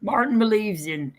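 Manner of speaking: ordinary delivery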